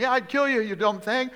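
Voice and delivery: high, loud hammed-up rant; furious Balaam. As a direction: berate the donkey. Balaam argues with donkey.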